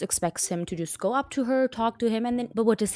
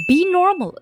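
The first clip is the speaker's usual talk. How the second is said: loud, high snap of disbelief; fed-up outburst; exasperated shout; 9 semitones higher, 8 dB louder than usual. Real, not acted. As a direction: yell it at the book. Exasperated at cringe book.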